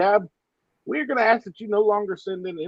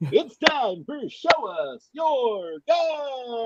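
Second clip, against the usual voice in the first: very slow drawn-out announcer call; grand entrance announcement; 9 semitones higher, much slower than usual. Acted. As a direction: stretch it like a ring announcer. Segment announcement again.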